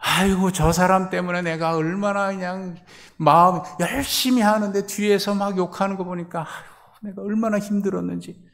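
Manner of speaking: high, loud whining grievance; complaining whiner. Acted. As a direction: moan about the one who made it hard. (높고 크게 푸념하는 목소리)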